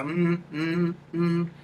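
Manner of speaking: hummed mm buzzing at the bridge of the nose; high nasal hum. Shown as a demonstration. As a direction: hum up where the eyes are. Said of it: "you go".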